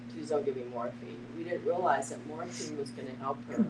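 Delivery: ordinary talk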